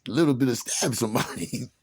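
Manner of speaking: normal talking voice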